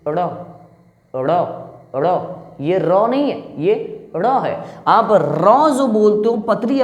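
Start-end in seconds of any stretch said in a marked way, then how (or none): 0.1-2.1 ड़ flicked off the roof of the mouth; retroflex flap ड़. Shown as a demonstration. Teacher modelling ड़ for students to repeat. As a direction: snap the tongue for ड़. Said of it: "आप बोलिए".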